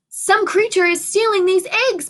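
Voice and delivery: high, put-on excited kid voice; urgent declaration. A narrator's reading. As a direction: announce the case eagerly. Buddy declares the mystery.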